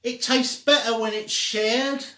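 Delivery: high, loud, clear call; calling out clearly. Acted. Violet calling out to Pie Weasel from her wheelchair, high, loud and clear. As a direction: call it out across the street. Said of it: "called out very clearly".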